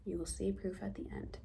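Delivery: hushed teaser whisper to the camera; whispered promise of proof. Real, not acted. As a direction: lean in and breathe it like a secret. Teaser aside to viewers.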